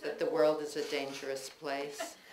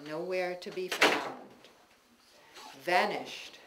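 ordinary delivery

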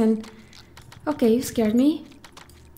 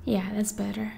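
whispering; 3 semitones lower, 7 dB quieter than usual. Real, not acted. Quiet moment in horror game.

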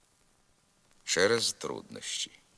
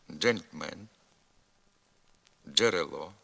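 each word set apart by long pauses; careful drill read with «дж» as one sound; slow model words for repeating; slower than usual. Shown as a demonstration. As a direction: say each word slowly and clearly for the class to echo. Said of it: "а ви повторюйте за мною".

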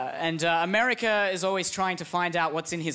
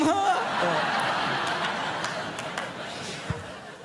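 giddy giggle; 11 semitones higher than usual. Acted. Sri Lanka giggles.

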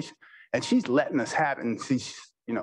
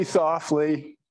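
ordinary delivery

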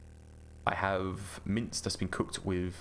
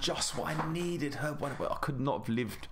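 hammed-up sarcastic relief; sarcastic gratitude; 5 semitones higher than usual. Mock thanks for job reward.

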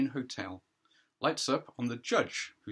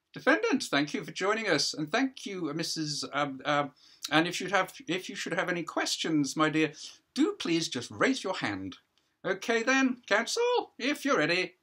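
higher, louder voice of a self-important judge; judge addressing a hearing; 8 semitones higher, 5 dB louder than usual. Acted. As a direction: play the judge holding court, a pitch up and projected.

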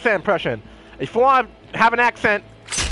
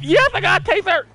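high-pitched gleeful cheer; excited cheer; 10 semitones higher than usual. Real, not acted. Player who just got a taser, excited cheer. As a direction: crow with delight over new loot.

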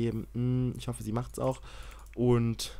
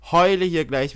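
louder, higher mock wail on heule; louder half-joking wail; louder whiny repeat; 5 semitones higher, 6 dB louder than usual. Real, not acted. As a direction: whine it out loud. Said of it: "repeat louder".

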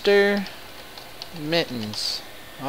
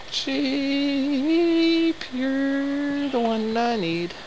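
cooing to a sheep; 14 semitones higher, much slower than usual. Real, not acted.

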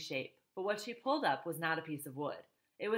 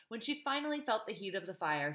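ordinary delivery

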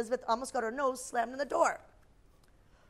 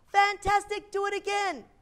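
high, loud, gushing wow; gushing praise; 10 semitones higher, 8 dB louder than usual. Acted. Queen eggs on the dragon, gushing excitedly. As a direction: egg him on with delight.

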